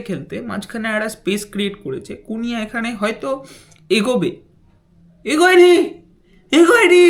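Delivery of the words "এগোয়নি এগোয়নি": excited. Real, not acted. Disbelief at the play.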